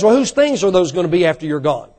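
ordinary talk, nothing marked

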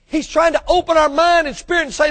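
ordinary talk

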